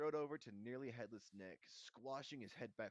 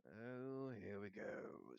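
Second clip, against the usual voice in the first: slow, weary resentment; bitter; much slower than usual. A narrator's reading. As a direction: drag it out sourly. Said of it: "said nearly headless Nick bitterly".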